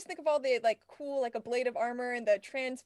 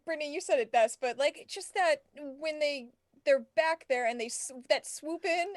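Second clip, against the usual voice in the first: usual speaking voice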